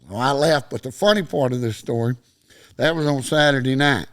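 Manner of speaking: usual speaking voice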